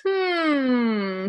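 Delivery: a thoughtful storybook hum; giraffe hum. Acted. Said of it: "said the giraffe".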